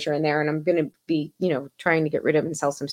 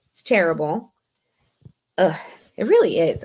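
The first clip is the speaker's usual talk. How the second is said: ordinary talk, nothing marked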